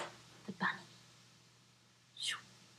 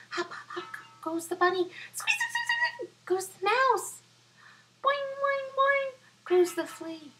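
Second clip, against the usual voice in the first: excited high voice; 5 dB louder than usual. Acted. Animals fleeing sounds.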